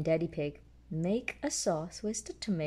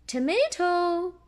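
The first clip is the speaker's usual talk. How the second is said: higher, louder mum pig; Mummy Pig voice; 10 semitones higher, 6 dB louder, slower than usual. A narrator's reading. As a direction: chime in brightly as the mother. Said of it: "chimes in Mummy Pig".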